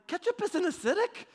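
high-pitched mock disbelief; retold high, incredulous comeback; incredulous protest. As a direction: squeak it out as a baffled objection. Retold protest to laughing dad.

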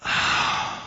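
soft breathy sigh; inward sigh. Shown as a demonstration. Preacher showing the congregation a soft sigh. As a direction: let out a quiet inward sigh. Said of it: "this is the inward sigh".

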